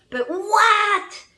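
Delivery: high, loud, stretched WHAT; exclaimed shock; incredulous outburst. Real, not acted. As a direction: burst out in disbelief. Exclaims at the twist.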